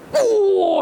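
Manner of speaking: high-pitched howl of pain; re-enacted pain scream. Preacher re-enacts screaming when his arm broke, high howl. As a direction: cry out as the bone cracks. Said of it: "saya ngejerit sejadi-jadinya".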